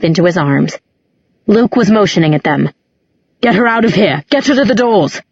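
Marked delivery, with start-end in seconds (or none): 3.4-5.2 urgent raised-pitch call, volume held back; mild shout. A narrator's reading. Luke motioning for Alaric to carry Clary away, mild shout. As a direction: read it as a restrained shouted command. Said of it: "he was shouting".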